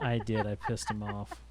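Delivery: soft embarrassed echo; sheepish admission. Real, not acted. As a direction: own up quietly. Player admits it.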